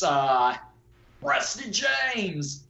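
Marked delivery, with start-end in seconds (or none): 1.2-2.7 slow, high Southern drawl on the name; Southern accent. Real, not acted. Drifts into Southern while praising the performance, Southern accent. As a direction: slips into a Southern accent without meaning to. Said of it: "I don't know why I'm saying in a Southern accent".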